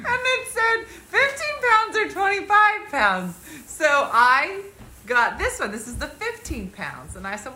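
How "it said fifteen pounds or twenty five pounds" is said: high, slow words through laughter; laughing. Real, not acted. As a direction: giggle through the numbers. Laughing retelling blanket weights.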